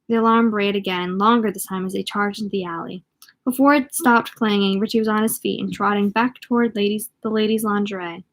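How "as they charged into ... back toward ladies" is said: ordinary delivery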